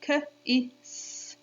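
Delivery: "K, I, S" as three isolated sounds, kuh, short i, sss; sounding out. Shown as a demonstration. Teacher proving only three sounds, segmented "K, I, S". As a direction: separate each phoneme in "K, I, S". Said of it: "Only three sounds".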